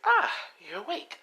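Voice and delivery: sweet and menacing, like a doll; creepy girl villain. Acted. Toga greeting captive Izuku on waking, high creepy sing-song.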